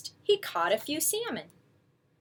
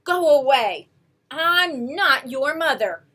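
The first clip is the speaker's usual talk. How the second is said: stern; 3 semitones higher, 6 dB louder than usual. Acted. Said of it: "Bruce was very stern".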